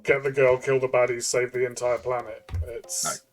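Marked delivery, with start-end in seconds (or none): none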